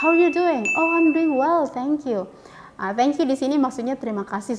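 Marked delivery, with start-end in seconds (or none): 0.0-2.3 cheerful acted dialogue.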